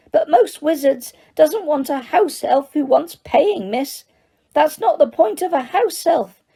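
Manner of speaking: high, squeaky elf voice, eager to please; squeaky house elf. Acted. House elf tells Harry he needs work, high and squeaky. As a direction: pitch it up as an excitable little elf. Dobby explains.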